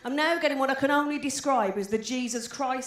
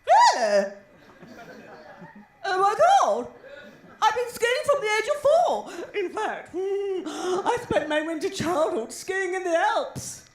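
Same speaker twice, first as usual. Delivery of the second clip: high, loud, hammed-up posh woman; 7 semitones higher, 5 dB louder, slower than usual. Posh woman shocked comedian never skied, high mocking posh voice. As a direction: imitate an appalled posh woman, voice swooping high. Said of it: "I met this posh woman recently. She was like this".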